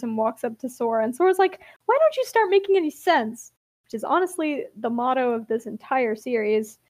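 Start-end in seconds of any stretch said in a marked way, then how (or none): 1.9-3.5 high, baffled and fed up; exasperated question. Retold or imitated. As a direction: ask it like nothing makes sense. Confused hero questions wizard.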